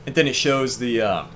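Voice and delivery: ordinary talk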